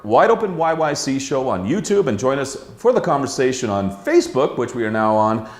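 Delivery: bright, quick announcer plug; show promo read. Acted. Host plugging the show to viewers, announcer style. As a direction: sell the show to the audience.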